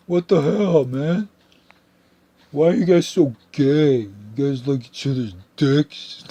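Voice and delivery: loud, high mocking voice of dumb bros; mocking dumb homophobes. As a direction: ham up a loud meathead jeering. Mocking bigots' voice.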